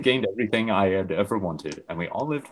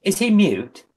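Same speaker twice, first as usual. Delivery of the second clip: higher voiced questioning; character voice; 11 semitones higher, 5 dB louder, slower than usual. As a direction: ask it like a puzzled guy. Narrator voices Jamie.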